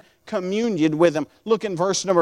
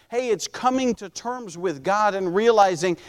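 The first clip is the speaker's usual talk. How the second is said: usual speaking voice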